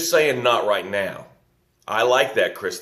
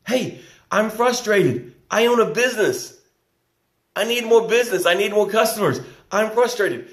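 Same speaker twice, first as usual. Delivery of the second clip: frustrated owner; 10 semitones higher than usual. Pretend. Voicing a frustrated business owner.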